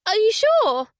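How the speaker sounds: high, loud, falling on sure; woman sorry a friend must leave; disappointed. Acted. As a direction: let the disappointment show. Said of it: "disappointment in Laura's voice".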